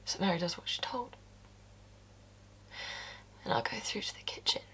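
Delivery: hushed, unvoiced telling; whisper. Mary obeying, told in a whisper. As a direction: whisper it, heavy with dread. Quiet narration after the order.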